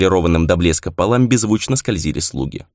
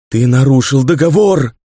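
loud, raging cry, hitting договор; furious ghostly shout; 5 semitones higher, 5 dB louder than usual. A narrator's reading. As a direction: hurl it out in fury. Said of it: "выкрикнула она с яростью". (яростный крик призрака)

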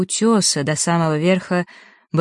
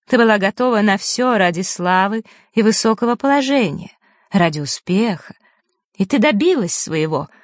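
bitter; 5 semitones higher, 3 dB louder than usual. Acted. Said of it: "продолжила она с горечью".

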